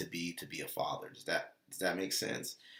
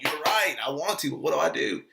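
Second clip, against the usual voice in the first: pleading agreement; 7 semitones higher, 7 dB louder, faster than usual. Retold or imitated. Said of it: "And I'm like".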